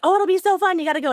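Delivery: imitating his high, upbeat pitch; eager invitation. Mimicking the athlete boyfriend urging her onto the trail, upbeat and high. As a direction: sell the bike ride like it's nothing.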